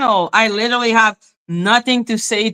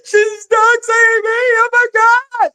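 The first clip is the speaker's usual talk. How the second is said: hammed-up whiny wail, climbing higher; mock crying; 11 semitones higher than usual. Mocking how the woman will cry over her shown name, mock crying. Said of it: "she's not gonna go cry".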